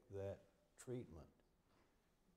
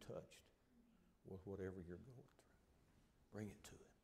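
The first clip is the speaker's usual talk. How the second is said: soft unvoiced plea, much softer; whispered appeal; 2 semitones lower, 14 dB quieter than usual. Real, not acted. Preacher urging the congregation in a hushed whisper. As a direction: breathe it to them tenderly.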